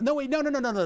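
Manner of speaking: sudden alarmed no's; panicked no. Real, not acted. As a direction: catch yourself in a panic. Realizes mistake, panicked no's.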